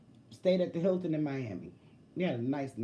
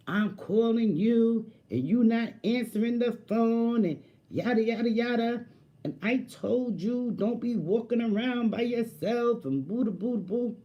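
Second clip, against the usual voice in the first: mock ranting boyfriend; 6 semitones higher than usual. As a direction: ham up his fit. Mocking his fit.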